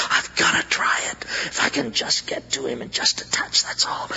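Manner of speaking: urgent, whispered resolve; desperate whisper. Acted. Isolated woman resolves to reach Jesus, whispering urgently. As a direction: whisper it to yourself with desperate hope.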